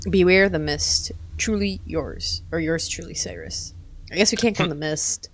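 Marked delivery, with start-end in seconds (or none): 0.1-3.7 dramatic letter reading. Acted. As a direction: read it like a creepy invitation. Reads the game's letter aloud.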